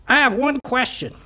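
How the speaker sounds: high parrot voice, toned down. Acted. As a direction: ask as a chastened parrot, still high-pitched. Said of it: "The parrot says".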